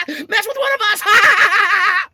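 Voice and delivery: high, loud laughing shout; cackling getaway taunt. Retold or imitated. Aunt speeding away, taunting with a loud cackle. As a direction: crow and cackle in triumph as she drives off. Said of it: "peels out running away going".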